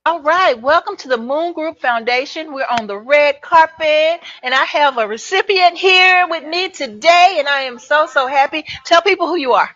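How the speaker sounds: loud, upbeat red carpet host; gushing red carpet chatter. Acted.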